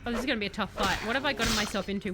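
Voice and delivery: usual speaking voice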